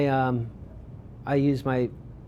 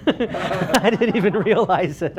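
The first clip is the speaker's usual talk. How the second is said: laughing while telling it, slowed down; 5 semitones higher, 4 dB louder, slower than usual. Real, not acted. Photographer laughing at his own blunder with the guard, laughing. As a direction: chuckle through the embarrassed admission.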